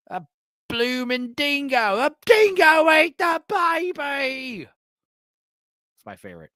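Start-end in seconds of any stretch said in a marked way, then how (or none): 0.1-4.7 high, stretched-out Aussie wail; exaggerated Australian accent. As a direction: cry it out in slow, cartoonish Australian. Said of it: "It's my favorite. Uh, the Australian accent".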